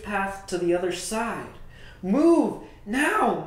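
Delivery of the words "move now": louder, higher command; firm order. Acted. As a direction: give the order with urgency. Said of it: "Moses ordered".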